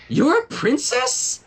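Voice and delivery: very high, loud and slow disbelief; can't believe what she sees; incredulous. A narrator's reading. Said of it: "said Trixie incredulously".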